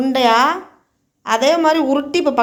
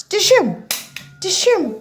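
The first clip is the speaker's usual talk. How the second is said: higher spoken dishoom gunshot noises; mock gunshot sounds; 4 semitones higher than usual. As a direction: say the bangs like a film fight. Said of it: "துப்பாக்கி சுடுறேன் நானுன்னு பாரு". (உயர்ந்த குரலில் டிஷ்யூம் ஒலி)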